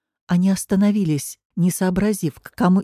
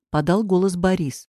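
plain reading voice

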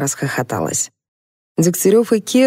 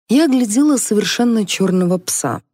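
ordinary delivery, nothing marked

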